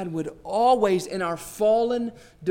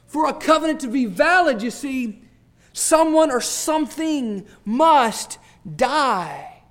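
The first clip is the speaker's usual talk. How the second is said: rising, louder preacher's swell; fervent preaching; 6 semitones higher, 6 dB louder than usual. Acted. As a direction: lift the congregation with it. Preaching at full force.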